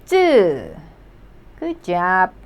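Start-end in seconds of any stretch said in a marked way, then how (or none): 0.0-0.8 쯔 as a tight, unaspirated jj with eu; tense Korean 쯔. Shown as a demonstration. Teacher explaining the double J, tense 쯔. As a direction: snap 쯔 out tense. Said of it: "it is pronounced as".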